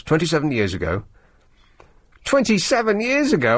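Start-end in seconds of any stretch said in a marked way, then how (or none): 2.2-3.6 high incredulous double take; suddenly realising, disbelieving; surprised. Real, not acted. Surprised rereading the date.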